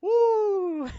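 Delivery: high, loud cheer; excited whoop. Real, not acted. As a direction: let out a whoop.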